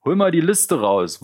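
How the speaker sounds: high, loud and taunting; loud mocking call. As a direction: egg the gang on, loud. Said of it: "rief der Anführer".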